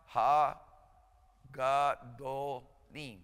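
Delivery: slow syllables. Shown as a demonstration. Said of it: "And start off".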